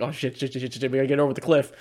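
rapid, low-key stream of words; retold panic. Retelling being chased to a cliff in the game, hurried mock panic. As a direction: rattle it off in a quiet mock panic. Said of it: "We're like".

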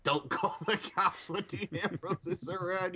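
high-pitched line through laughter; high, giggly delivery; laughing repeat. Real, not acted. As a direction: repeat the joke while cracking up. Cracking up repeating co-host's joke.

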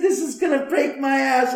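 high, loud indignant complaint; retold exasperated outburst. Homeowner retelling his rant at the ghosts, high exasperated outburst. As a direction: protest loudly, pitch shooting way up.

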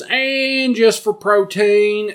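high, slow sly aside; sly host voice. Retold or imitated. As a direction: drawl it like a treat. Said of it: "And he goes".

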